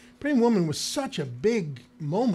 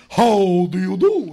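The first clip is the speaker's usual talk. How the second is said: slow, stagey greeting; hammed-up theatrical delivery, drawn out; mock actory; 9 dB louder, slower than usual. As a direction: overact it like a grand thespian. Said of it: "Don't do anything actory".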